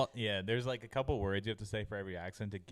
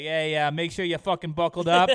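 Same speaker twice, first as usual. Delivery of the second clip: louder, higher mock Boston flight attendant; hammed-up Boston attendant barking orders; mock Boston accent; 6 semitones higher, 10 dB louder than usual. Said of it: "Australian towards the end there".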